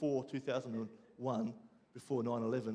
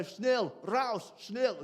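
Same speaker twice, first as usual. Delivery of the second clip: barked German words, louder and higher; mock German command; 6 semitones higher, 4 dB louder, much slower than usual. To the taxi driver, a loud mock German order. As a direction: bark it like a TV prison guard. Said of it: "and I say".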